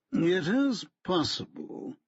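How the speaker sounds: slow, haughty barrister voice; cool, superior solicitor; stiff lawyer. A narrator's reading. Lawyer brushing off Fincham, haughty.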